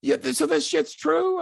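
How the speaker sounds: high, loud, exasperated demand; imitating a furious colleague's phone call; angry Cornette. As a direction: bark it like a furious boss on the phone. Cornette voiced in disbelief.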